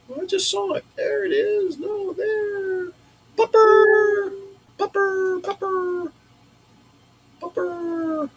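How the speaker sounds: high, cooing pet voice. Real, not acted. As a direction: coo at the puppy sweetly. Talking to the dog.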